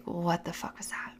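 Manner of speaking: a hushed, shaken whisper under the breath; startled whisper. Retold or imitated. Lying in bed after a shadow darts past, frightened whisper. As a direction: whisper it as if afraid to wake the room.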